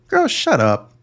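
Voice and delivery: high-pitched exasperated dismissal; exaggerated fed-up telling-off; mock exasperation. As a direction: shut them down in a high, hammed-up voice. Mock telling-off of woman in clip.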